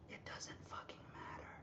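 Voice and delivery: hushed whisper for effect; whispered punchline. Real, not acted. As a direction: drop to a secret whisper. Punchline aside to chat.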